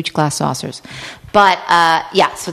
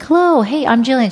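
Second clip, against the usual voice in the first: chirpy hello; 7 semitones higher than usual. Retold or imitated. Cheery greeting to neighbor.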